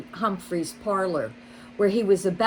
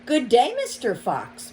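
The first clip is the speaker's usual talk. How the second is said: light, higher voice for the rabbit; a polite shopkeeper rabbit, a little raised; Missus Rabbit voice; 5 semitones higher, 3 dB louder than usual. A narrator's reading. Rabbit greeting the fox politely, light higher voice. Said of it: "Missus Rabbit said".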